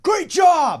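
high, loud sideline cheer; mock proud-parent holler; cheering yell. Shown as a demonstration. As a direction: shout it like a parent cheering on a kid. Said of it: "do you ever yell".

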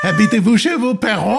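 high, hammy French question; mock French accent. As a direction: pitch it up in over-the-top French. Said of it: "With a terrible accent".

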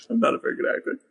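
high, amused actor impression; Malkovich. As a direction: deliver a smiling mock confession. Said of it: "Smiles at him".

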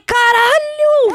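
very high, long drawn-out cry; awed exclamation. Retold or imitated. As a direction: stretch it out in amazed delight. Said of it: "Eu falei".

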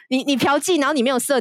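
usual speaking voice

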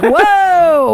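loud, slow, high whoa; drawn-out surprised whoa. Real, not acted. Reacting to baby boners.

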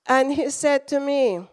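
ordinary delivery

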